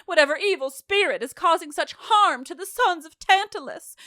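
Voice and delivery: high, hammed-up wail; mock-dramatic invocation. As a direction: call on spirits with theatrical dread. Hammed-up mocking of chorus's invocation.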